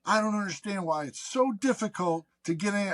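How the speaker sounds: high, exasperated complaining woman, laughing through it; mock the frustrated caller's whine; hammed-up annoyed customer; whiny caller. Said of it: "And she goes".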